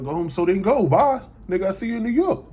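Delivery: loud, high-pitched retold send-off; irritated dismissal. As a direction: brush him off loudly, as in a retold spat. Acted dismissal of the friend.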